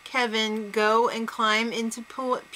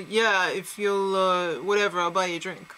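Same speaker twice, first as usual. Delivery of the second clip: lame pickup line; 4 semitones lower than usual. Retold or imitated. Host mimicking a housemate's weak flirting, low and halting. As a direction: mumble through a half-hearted pickup attempt. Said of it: "Greg's moves weren't that great".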